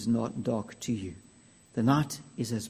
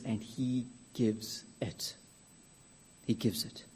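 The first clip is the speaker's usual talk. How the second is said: soft, slow near-whisper; hushed; 9 dB quieter, slower than usual. Acted. Preacher on Jesus giving mercy, softly whispered. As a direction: let it fall to a hush.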